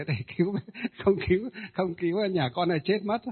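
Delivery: hastily voiced begging ghost, half-laughing; mock desperate plea. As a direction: plead quickly like the wailing spirit. Possessed woman begging the monk. (giả giọng vong van xin)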